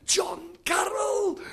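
long, high-pitched call across a distance; hammed-up joyful holler; calling out greeting. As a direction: cry out the names to greet old friends. Evangelist greets friends across car park.

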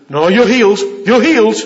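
loud, high insistence; bolshy. Acted. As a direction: push it on them forcefully, mocking. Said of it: "You don't have to be bolshy".

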